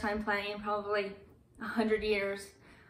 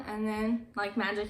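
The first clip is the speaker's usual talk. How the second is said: usual speaking voice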